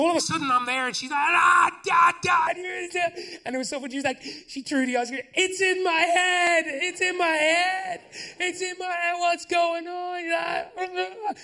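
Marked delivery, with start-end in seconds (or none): 1.2-3.2 put-on high shouted toddler wail; toddler brain freeze wail. Toddler wailing from ice cream brain freeze, high and loud. 5.3-11.4 put-on high, slow crying child voice; wailing toddler. Toddler crying about the cold in her head, high and wailing.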